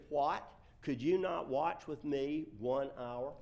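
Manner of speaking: loud, emphatic quoted question; forceful, deliberate reading aloud; scripture reading. Acted. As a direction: project the rebuking question from the verse. Reads scripture aloud to congregation.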